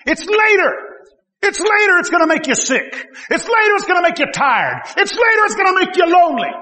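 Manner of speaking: high, loud building warning; preaching shout. Real, not acted. Preacher warning young people, shouted emphasis. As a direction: hammer the word later each time.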